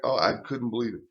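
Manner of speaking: ordinary talk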